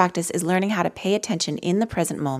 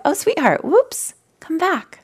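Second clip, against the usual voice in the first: put-on higher, tender coaxing to a child; gentle, kind; 10 semitones higher, slower than usual. To her wandering mind, higher and tender. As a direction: call the mind back like a sweetheart. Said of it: "There's a gentleness, there's a kindness".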